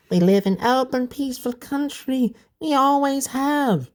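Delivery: put-on a child explaining earnestly in a high voice. A narrator's reading. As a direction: speak up high like a little kid. Child character voiced in story.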